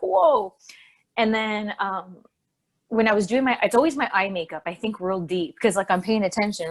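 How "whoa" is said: mock stoned whoa. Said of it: "all kinda like".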